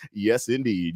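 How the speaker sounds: ordinary delivery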